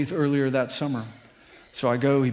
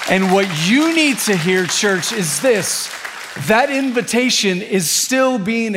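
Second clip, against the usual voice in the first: rising, louder preaching with punched repeated phrases; full-force preaching; 4 semitones higher, 5 dB louder than usual. Acted. Pastor preaching to the church, calling prodigals home with rising fervour. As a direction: build it up and call the church home.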